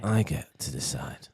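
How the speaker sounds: low slow whisper. Pretend. Echoing the whisper.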